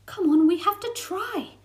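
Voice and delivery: insisting. Acted. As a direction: push her to agree. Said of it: "Frosty insisted".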